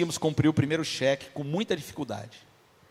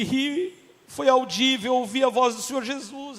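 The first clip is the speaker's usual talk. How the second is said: tearful wife; 8 semitones higher, slower than usual. Retold or imitated. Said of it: "Ela chorava".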